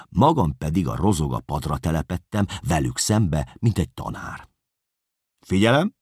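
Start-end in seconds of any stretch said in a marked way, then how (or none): 5.4-5.9 called out high and loud like a teacher; sharp classroom call for attention; teacherly call. Acted. Narrator calls class to attention.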